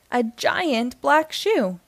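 ordinary delivery, nothing marked

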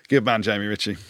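usual speaking voice

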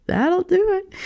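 high, slow, laughing repeat. Shown as a demonstration.

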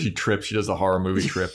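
ordinary talk

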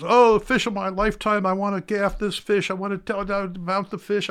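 giddy high-pitched client gushing over his catch; thrilled client gushing about gaffing his fish of a lifetime, high giddy voice; excited client. Retold or imitated. Gush it high, like a giddy tourist. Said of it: "And he goes".

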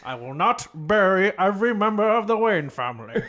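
a Muppet version of a British butler; cartoonish British butler voice breaking into laughter; Michael Caine impression. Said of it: "kind of like a Muppet version of Michael Caine".

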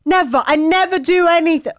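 high, loud mock sulky outburst; mock tantrum. Teenager sulking at her mum, mock tantrum. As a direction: huff like a stroppy teen. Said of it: "My mum's like".

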